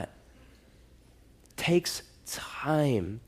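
slow, whispered reminder. Real, not acted. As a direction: lean in and whisper it slowly. Drops to a whisper mid-sermon.

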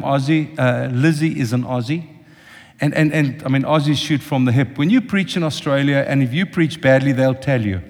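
ordinary talk

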